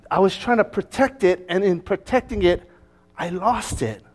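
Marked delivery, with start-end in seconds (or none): none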